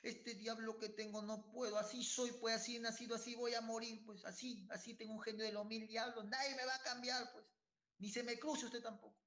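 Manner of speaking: voz mucho más aguda y desafiante; terco orgulloso. Retold or imitated. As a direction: imita al terco que se jacta de su genio. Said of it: "Algunos dicen". (el fiel terco al pastorcito, agudo y desafiante)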